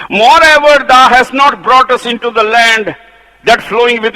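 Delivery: loud, high proclaiming of the verse; fiery scripture reading. Acted. Preacher reads the rebels' defiance aloud, thundering. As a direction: thunder the rebels' words from the text.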